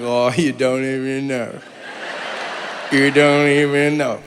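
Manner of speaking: slow, low, knowing drawn-out taunt; smug secret-keeper. Retold or imitated. Keeping a cool secret from people, slow smug knowing. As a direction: stretch it out, savouring the secret.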